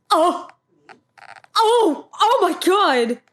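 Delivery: high, loud gasping exclamations; real overwhelmed gasping; overwhelmed gasps. Real, not acted. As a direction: let it burst out of you. Overwhelmed reaction to the scene.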